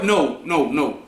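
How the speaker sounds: ordinary talk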